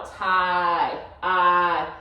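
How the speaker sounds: "Ti" held as tie, then "I" alone as a long eye; "Ti" and "I" drawn out as separate sounds; long vowel sound. Shown as a demonstration. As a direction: stretch "Ti", then isolate its end sound "I". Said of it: "That ends in a vowel".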